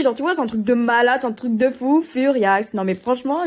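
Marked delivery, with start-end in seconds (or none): none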